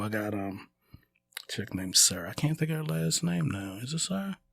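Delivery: ordinary delivery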